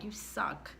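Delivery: ordinary talk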